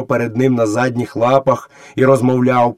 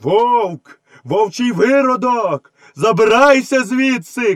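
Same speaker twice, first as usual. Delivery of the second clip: high, drawn-out cry; priest shouting; 11 semitones higher, slower than usual. A narrator's reading. Priest driving the boy away, shouting. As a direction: call out slowly and high, banishing him. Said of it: "кричав жрець".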